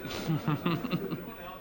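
real high chuckle; chuckling. Real, not acted. Performer to heckler asking about money, chuckling. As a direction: chuckle after a retort. Laughs after retort to heckler.

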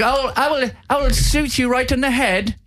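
very high-pitched bad English accent; terrible English accent. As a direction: squeal out a badly faked English accent. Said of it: "terrible English accent".

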